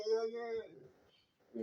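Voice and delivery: high-pitched celebratory yeahs; imitated cheering. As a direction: play the friends cheering along. Friends cheering, retold.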